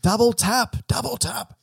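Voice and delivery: high, playful like-begging call; mock influencer plea. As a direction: ham it up like a YouTuber asking for likes. Joking about getting more likes.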